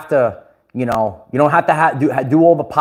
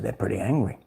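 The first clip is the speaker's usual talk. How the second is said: hushed aside; 6 semitones lower, 11 dB quieter, faster than usual. Retold or imitated. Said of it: "I said".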